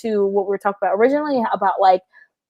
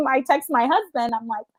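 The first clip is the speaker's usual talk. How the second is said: ordinary delivery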